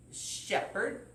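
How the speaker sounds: ordinary delivery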